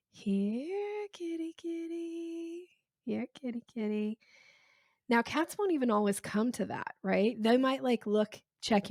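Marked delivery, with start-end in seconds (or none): 0.1-2.7 high, soft, slow "kitty, kitty" coo; cat-calling voice. Shown as a demonstration. Calling a cat over, high soft slow coax. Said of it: "You call a cat by going". 3.1-4.2 "Here, kitty" barely raised, softer coax; lighter cat call. Shown as a demonstration. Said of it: "You call a cat by going".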